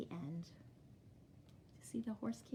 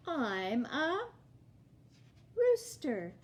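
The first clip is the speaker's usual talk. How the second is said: sing-song picture-book read-aloud, each clue lifted; reading to children. Acted. Teacher reading a picture book to her class, storytime sing-song. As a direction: read it like storytime for little kids.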